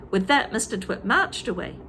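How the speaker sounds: usual speaking voice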